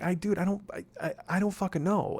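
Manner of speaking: retold exasperation, voice climbing high; frustrated. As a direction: throw up your hands, can't take it. Frustrated retelling of the call.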